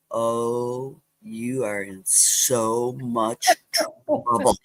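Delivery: slow, low, lightly voiced taunt from a big brother; a hint of a sing-song tease; mock gloating brother. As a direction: drag it out like a smug brother with a secret. Brother gloating at little sister.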